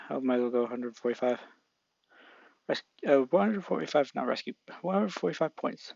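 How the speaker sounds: ordinary delivery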